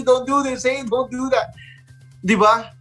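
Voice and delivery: ordinary delivery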